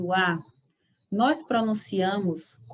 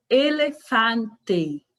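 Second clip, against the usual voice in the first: very slow "Elefante", every e closed; slow Spanish elefante; much slower than usual. Shown as a demonstration. Teacher modeling elefante slowly. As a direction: spell it out slowly, closed e's. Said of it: "Pronuncie em suas casas".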